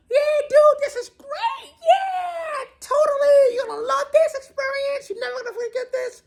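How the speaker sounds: very high, shouted surfer-dude cheering; stoked hippie dude. Retold or imitated. Instructor behind him shouting encouragement during the fall, high dude voice. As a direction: ham up the instructor whooping with joy. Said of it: "He's behind me".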